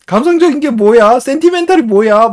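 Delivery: 아주 높고 큰 아이 목소리; 엿듣다 불쑥 끼어들듯 높게 묻기; 아이의 천진한 질문 흉내. Retold or imitated. 엿듣던 아이가 놀리는 장면. (높게 외치는 꼬마 흉내)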